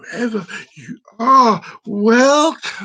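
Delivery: slow, swelling showman's greeting; grand mock welcome. Host welcoming the mystery viewer, grand drawn-out greeting. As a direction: draw it out grandly in full voice. Same greeting, now in full voice.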